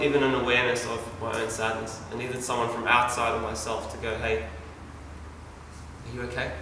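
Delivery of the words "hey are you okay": slow, hushed concern; a near-whispered, slow caring question; gentle check-in. Retold or imitated. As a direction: ask softly, almost breathed. Someone outside checks on him.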